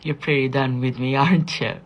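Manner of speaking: retold smiling challenge; smiling confrontation. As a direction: confront him loudly with a smile. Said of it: "I smiled and said".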